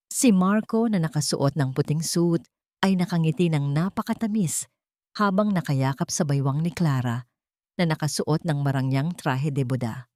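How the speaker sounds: plain reading voice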